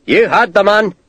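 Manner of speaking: Scottish sergeant bellowing. A narrator's reading. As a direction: bellow at the men. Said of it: "the Scottish sergeant bellowed".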